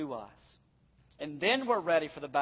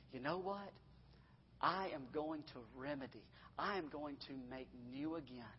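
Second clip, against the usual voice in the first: soft, lowered voice of assurance; gentle promise; 3 semitones lower, 11 dB quieter than usual. Acted. Preacher voicing God's promise to remedy, gentle assurance. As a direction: promise it quietly and firmly.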